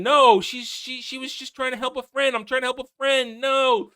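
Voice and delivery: a hint of a pleading little girl; mocking Nori. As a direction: whine it like the girl.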